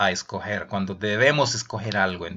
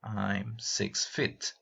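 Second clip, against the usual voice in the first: very slow, softer repeat; slow soft model answer; 5 dB quieter, much slower than usual. Shown as a demonstration. Teacher repeating the answer slowly for students. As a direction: stretch each word out gently.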